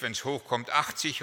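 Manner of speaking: ordinary talk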